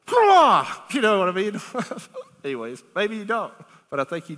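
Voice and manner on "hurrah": sharp gasp, then loud high hurrah; gasp, then explode in a cheer; exultant burst. Acted. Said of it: "and you're like".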